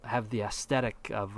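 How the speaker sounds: ordinary talk, nothing marked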